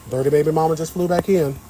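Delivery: ordinary talk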